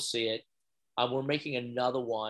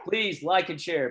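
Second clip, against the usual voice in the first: bright, pitched-up request; upbeat plea; 6 semitones higher, 4 dB louder than usual. Shown as a demonstration. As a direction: sell it like a host. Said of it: "That's what my crew is telling me".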